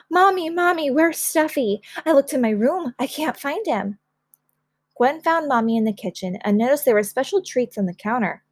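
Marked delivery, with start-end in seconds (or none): 0.1-4.0 put-on high, worried child's cry for help; panicked calling. A narrator's reading. Child who lost a stuffed rabbit, high and panicky. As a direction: call for mom in a mild panic. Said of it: "in a panic, shouting".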